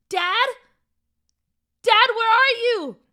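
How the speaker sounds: high, loud searching call. Acted. Boy looking around for his dad, calling out. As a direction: yell out for a missing parent.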